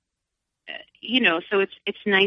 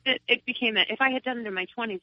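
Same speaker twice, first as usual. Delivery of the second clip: ordinary talk, nothing marked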